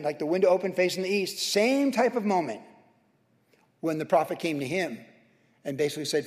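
usual speaking voice

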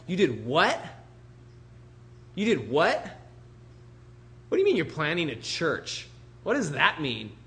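baffled relative, swooping up on "what"; acted disbelieving interrogation; mock incredulity. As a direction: question it as if it makes no sense. Acting out baffled family members.

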